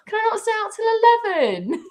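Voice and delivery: put-on high pleading teen whine; teen begging parent to stay out later, whiny; whiny teenager. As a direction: beg like a teenager for a later curfew.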